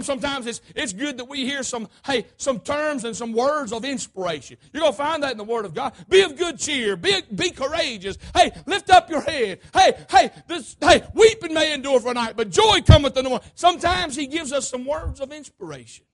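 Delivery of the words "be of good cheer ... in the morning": loud, raised preacher's exhortation; rally the congregation with scripture; punchy, high-energy pulpit encouragement; rousing preaching. Real, not acted. Preaches encouragement at full force.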